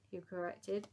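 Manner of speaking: ordinary delivery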